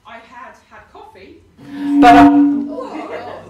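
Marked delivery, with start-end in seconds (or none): 2.0-3.5 loud laughing outburst, drawn out; laughing hard, losing the words; burst of laughter. Real, not acted. Preacher cracking up at her own story, laughing loudly.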